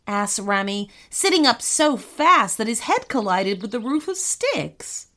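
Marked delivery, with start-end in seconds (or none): none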